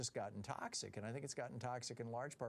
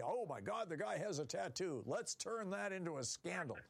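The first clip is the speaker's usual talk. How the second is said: higher, hammed-up gasp of fake scandal; mock outrage; 7 semitones higher than usual. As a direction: play the breathless scandal-monger, pitched up. Mocking scandal-hungry media.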